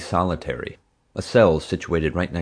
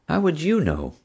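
higher, suspicious question; wife questioning her husband suspiciously, higher; 11 semitones higher than usual. A narrator's reading. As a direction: narrow it down with suspicion. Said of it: "Mom asked suspiciously".

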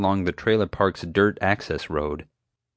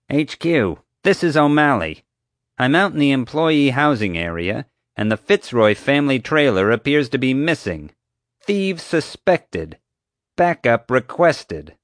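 higher, emphatic security guard report; official radio call; 7 semitones higher, 4 dB louder than usual. A narrator's reading. Guard radioing headquarters about a missing trailer, official. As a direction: call it in with self-important formality.

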